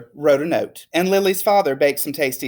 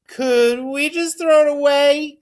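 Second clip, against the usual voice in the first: high, louder, slower eager question; hopeful child; 11 semitones higher, 4 dB louder, slower than usual. Acted. Lily asks her teacher about the drawing, high eager child voice. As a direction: ask it like a hopeful kid. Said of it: "asked Lily".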